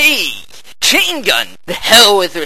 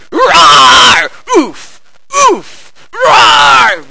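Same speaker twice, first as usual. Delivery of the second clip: roaring; 7 semitones higher, 6 dB louder, much slower than usual. Acted. Roaring at a monster.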